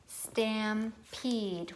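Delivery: "Stampede" slowly in two separate beats; syllable by syllable. Shown as a demonstration. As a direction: pause between stam and peed in "Stampede". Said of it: "What's the second syllable".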